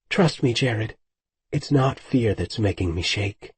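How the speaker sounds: ordinary delivery, nothing marked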